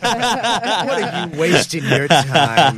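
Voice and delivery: loud, drawn-out mock pleading; Matt Maron. Comic mimicking Matt urging Lewis to leave, loud impression. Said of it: "Matt Maron impressions".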